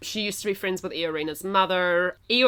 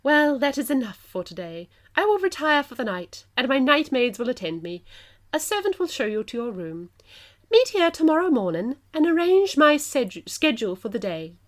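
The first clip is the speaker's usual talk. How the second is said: high plummy English queen dismissing; plummy English queen ending the meeting; plummy English accent; 5 semitones higher than usual. Acted. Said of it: "my plummiest English accent".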